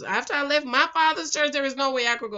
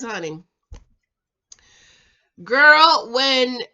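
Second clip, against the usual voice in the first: ordinary talk, nothing marked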